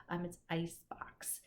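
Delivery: ordinary delivery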